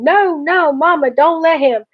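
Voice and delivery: high, loud muffled-mouth protest; panicked mumbling. A narrator's reading. Frantic stuck brother mumbles to Mama, panicked mumbling. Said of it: "and mumbling".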